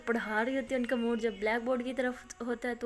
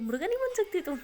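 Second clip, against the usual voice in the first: higher, mock-indignant dare; teasing challenge; 8 semitones higher than usual. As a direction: needle her playfully. (شوخی بھرے طعنے کے انداز میں)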